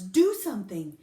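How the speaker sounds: loud urgent demand. A narrator's reading. Nana ordering Lucille's daddy to act, loud. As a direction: bark it at him. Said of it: "she said the words".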